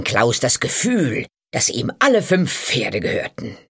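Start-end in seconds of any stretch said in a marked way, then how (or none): none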